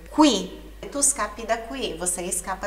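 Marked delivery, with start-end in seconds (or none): 0.1-0.6 "qui" dito kuí, com o U soando; faça soar o U de "qui", alto; U pronunciado. Shown as a demonstration. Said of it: "vai ser sempre pronunciado". ("qui" como kuí, com U audível)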